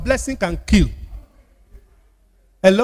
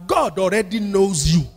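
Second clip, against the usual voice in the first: normal talking voice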